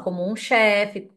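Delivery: ordinary delivery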